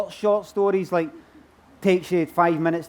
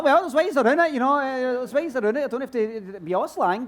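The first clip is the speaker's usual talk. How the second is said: eager to please; 6 semitones higher than usual. Retold or imitated. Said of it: "I was like".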